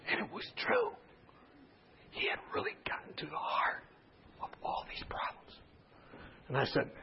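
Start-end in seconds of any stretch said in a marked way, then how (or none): 0.1-5.6 hushed, confiding storytelling whisper; whispered narration. Real, not acted. Preacher explains the patient's insight, whispered.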